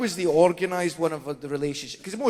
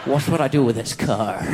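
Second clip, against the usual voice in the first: put-on Northern Irish accent; 6 dB louder than usual. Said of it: "a Northern Irish person".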